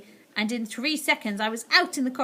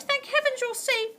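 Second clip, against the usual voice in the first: higher, relieved; relieved grandmother. A narrator's reading. Grandmother relieved her grandson is safe. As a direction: breathe out relief.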